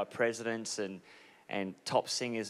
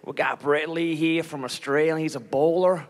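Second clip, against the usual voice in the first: put-on a half-hearted American announcer accent, a bit higher and brighter in pitch; a rough, self-confessed bad American accent; an Australian imitating an American stadium announcer, half-hearted; attempted American accent; 4 semitones higher, 3 dB louder than usual. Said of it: "I can't do the accent".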